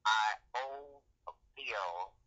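ordinary talk, nothing marked

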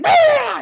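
sudden high, loud "bah" yell; jump-scare roar. Retold or imitated. Cousin jumps out at the boy, scare yell. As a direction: burst out loud to startle someone.

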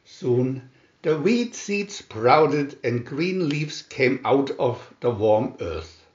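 plain reading voice

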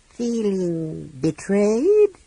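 high, slow, casual dragon taunt. A narrator's reading. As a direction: toy with them, slow and mocking. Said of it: "the dragon asked casually".

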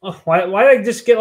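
usual speaking voice

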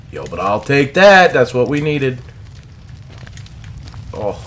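normal talking voice

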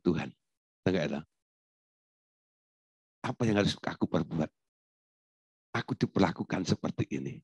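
low, soft questioning; hushed, humble asking, pitch dropped; private prayer. Acted. Believer asking God what to do, quiet prayer. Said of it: "Anda tanya Tuhan".

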